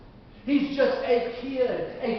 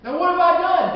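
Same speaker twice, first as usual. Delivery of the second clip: loud, higher exasperated whine; indignant protest; 7 semitones higher, 7 dB louder than usual. Acted. David protesting to his older brother, exasperated. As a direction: protest innocence to a nagging brother.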